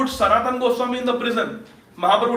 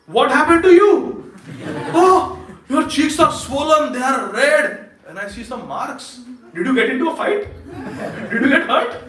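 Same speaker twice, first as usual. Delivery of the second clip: laughing surprise; 4 semitones higher than usual. Acted. Swarup Damodar laughs at Pundarik's swollen cheeks, higher and amused. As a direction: tease a friend's swollen face with a laugh.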